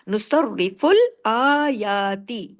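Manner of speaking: नुसर रिफुल आयाती very slow, आयाती stretched; drawn recitation. Shown as a demonstration. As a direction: draw out आयाती for an alif. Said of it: "अलिफ के बराबर खींच के".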